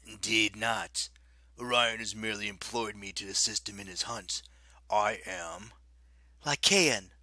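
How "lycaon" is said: loud interrupting name; cutting in. A narrator's reading. Raina interrupting the werewolf, loud and quick. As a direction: snap the name in to cut him off. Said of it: "Raina interrupted".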